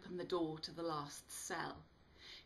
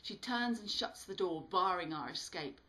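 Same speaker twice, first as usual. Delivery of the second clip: plain reading voice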